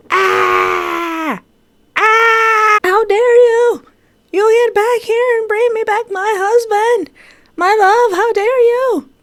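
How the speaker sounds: very high, loud shrieking fury; shrieking woman. Acted. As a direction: scream like a furious wife. Woman character screams at villain.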